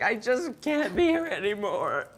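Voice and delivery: high, slow shrieking cry; imitated screech. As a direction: screech it out like you just escaped. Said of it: "like that in that screech".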